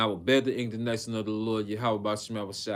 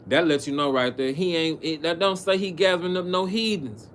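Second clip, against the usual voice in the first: louder and higher, hammering the point home; preaching at full force; 7 semitones higher, 6 dB louder than usual. Acted. Preacher driving the point home to his brothers, loud and rising. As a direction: fire up the congregation.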